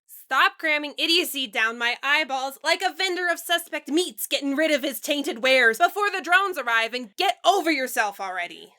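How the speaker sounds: loud, heated tirade; angry rant. Acted. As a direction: chew him out fast and loud.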